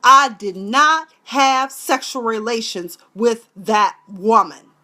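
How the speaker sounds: slow, loud, deliberate denial; hammed-up loud slow denial; mock denial speech. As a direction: deny it like a politician at a podium. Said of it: "I don't remember".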